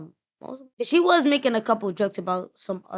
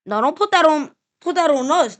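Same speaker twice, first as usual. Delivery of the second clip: raised, higher voice in protest; loud objection; 4 semitones higher, 8 dB louder than usual. Real, not acted. Listener defending New York, loudly objecting. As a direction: protest loudly and refuse the blame.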